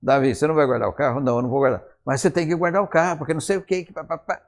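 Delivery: quick imitation of a nagging argument, rising in pitch; mock bickering. Husband and wife argue over the car, mock nagging. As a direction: bicker briskly, then tail off into blah-blah. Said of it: "A entonação de voz já é diferente".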